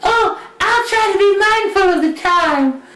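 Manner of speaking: high, sweet princess voice; a high, prim little promise; Cinderella. Acted. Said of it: "she was like".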